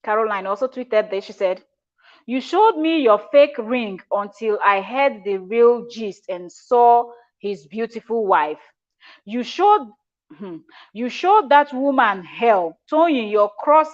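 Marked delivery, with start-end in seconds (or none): 2.3-13.9 slow taunting read. Acted. Reading tweet slowly.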